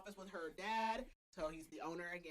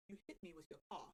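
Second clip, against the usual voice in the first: put-on soft, hesitant stab at a British accent; 10 dB quieter, faster than usual. Imitating the man she hit, tentative British accent. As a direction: try a British accent, quietly, on the edge of laughing. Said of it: "And he has a British accent".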